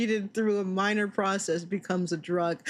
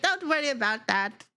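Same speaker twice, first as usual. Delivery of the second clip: a higher, dismissive mom brushing it off; breezy parent voice; 5 semitones higher than usual. Acted. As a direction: wave the kid's worry away and move on. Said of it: "and they're like".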